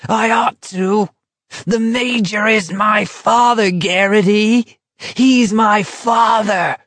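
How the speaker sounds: rising to a wild preacher's shout; pulpit shout. A narrator's reading. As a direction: build from intense to a full shout. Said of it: "His low-pitched voice rose to a pulpit shout".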